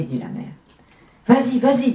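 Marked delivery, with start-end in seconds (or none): 1.3-1.9 put-on high, loud eager children; eager childlike urging; ogresses urging on. A narrator's reading. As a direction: egg her on, high and loud. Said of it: "disent les ogresses".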